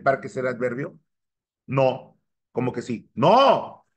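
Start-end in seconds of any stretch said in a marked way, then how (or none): 3.1-3.9 loud, high "no!"; emphatic objection. Real, not acted. Teacher rejecting a student's yes, emphatic objection. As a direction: cry out a big objecting no.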